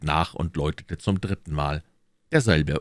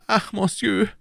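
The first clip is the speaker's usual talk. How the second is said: higher, louder nervous protest; anxious Bonacieux; 3 dB louder than usual. Acted. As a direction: plead his innocence. (ängstlicher Bonacieux)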